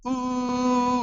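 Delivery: high, sustained soft ooh; long steady whine of a moving table; imitated machine hum. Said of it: "finally it's like".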